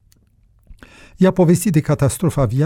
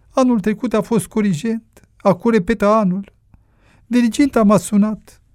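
high-pitched mother pouring out her troubles; plaintive, high motherly voice; worried old mother, lamenting; 9 semitones higher than usual. Acted. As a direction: lament over a lost son in a high voice.

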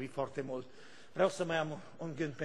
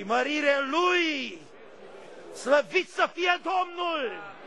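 higher, very slow, loud praise; preaching shout; 9 semitones higher, 5 dB louder, much slower than usual. Acted. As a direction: proclaim it to the hall. Preacher's exclamation.

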